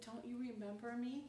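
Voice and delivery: high, small put-on voice; talking face's plea. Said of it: "And he said".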